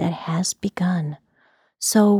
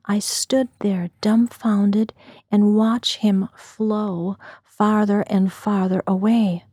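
ordinary delivery

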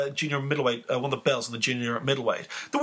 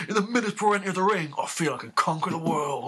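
put-on higher, laughing Cockney accent; 3 semitones higher than usual. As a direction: quote it in a cheeky Cockney accent. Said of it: "I can put this in another Cockney accent".

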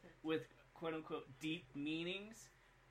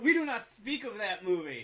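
mock outrage; 5 semitones higher, 12 dB louder than usual. Mock ban on mentioning bad film.

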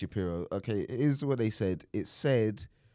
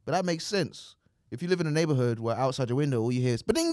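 normal talking voice

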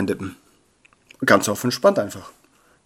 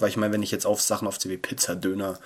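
normal talking voice

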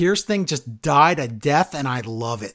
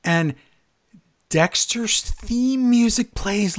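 usual speaking voice

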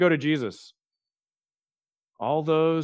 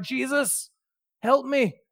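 desperate cry; 9 semitones higher, slower than usual. Retold or imitated. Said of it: "all he can do is cry out".

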